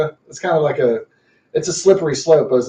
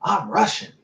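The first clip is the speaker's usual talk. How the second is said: high-pitched imitation of pride; proud declaration; 7 semitones higher than usual. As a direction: announce your origins proudly, voice lifted high.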